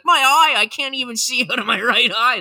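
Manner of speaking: high, loud mock wail about his eye; mock whimpering. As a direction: whine like a big baby faking an injury. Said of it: "and be like".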